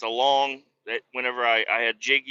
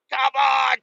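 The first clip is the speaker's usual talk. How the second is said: frustrated yell; 9 semitones higher, 4 dB louder than usual. Retold or imitated. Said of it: "I'm just like".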